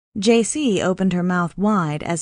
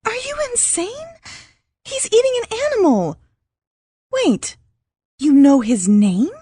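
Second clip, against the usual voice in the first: much higher, frantic disbelief; panicked; 14 semitones higher, 5 dB louder than usual. Acted. J C confronting Max about Jerry, high panicked outburst. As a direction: voice climbing in horror.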